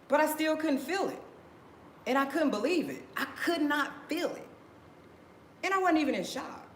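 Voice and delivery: high-pitched, insistent disbelief; emphatic disbelief. Real, not acted. Own disbelief at the news.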